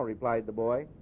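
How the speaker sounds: usual speaking voice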